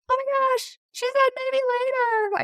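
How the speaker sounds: put-on high, gushing childish excitement; excited gasp. A mother retelling how her childhood self squealed at her parent's maybe, high and giddy. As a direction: squeal it like a hopeful kid who heard maybe. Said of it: "And I'm like".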